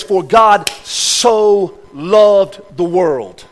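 "For God so loved the world." stretched out very slowly; slow recitation. Acted. Preacher savouring John three sixteen, very slow. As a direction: lay each word down slowly.

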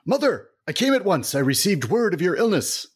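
high, loud melodramatic son voice; dramatic son. Acted. Doctor son arriving at his sick mother's, hammed up high and loud. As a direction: ham up the devoted doctor son.

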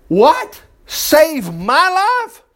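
high, incredulous royal outburst; furious king. Acted. King reacting to Esther's plea, furious and incredulous. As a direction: stretch it out in mock disbelief. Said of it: "he's already furious".

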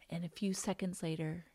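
ordinary talk, nothing marked

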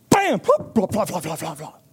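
storyteller punching the trap snapping shut; sudden bam. A narrator's reading. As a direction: snap it out like the trap.

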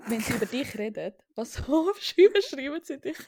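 normal talking voice

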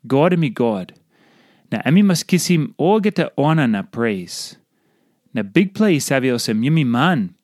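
normal talking voice

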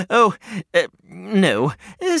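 ordinary delivery

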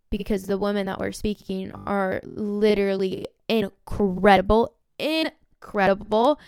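drawn-out incredible; stretched emphasis. Real, not acted. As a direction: incredible stretched slow and rising. Gushing about speakers.

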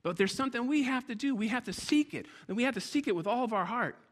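high, loud, driving preacher's build toward the altar call; preaching at full force. Acted. Pastor urging the church to seek God, preaching at full force.

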